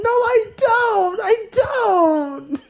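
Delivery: very high, slow tearful yell of denial; crying shout. Retold or imitated. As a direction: wail it like a kid in tears. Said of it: "I was like".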